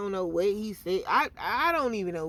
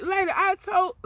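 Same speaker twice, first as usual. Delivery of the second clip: higher, louder outburst at the officer; retold yell; 11 semitones higher, 9 dB louder than usual. Telling the officer she already gave her address, shouting.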